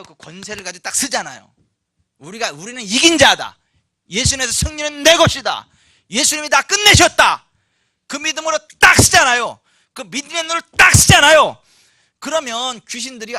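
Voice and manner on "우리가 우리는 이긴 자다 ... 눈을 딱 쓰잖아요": loud, high declarations of faith; full-force preaching. Acted. Preacher declaring believers' authority in Christ, forceful shouting. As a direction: proclaim victory at the top of your voice.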